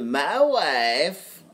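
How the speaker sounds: lightly voiced Borat accent on "my wife"; Borat impression. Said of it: "That's where that's from".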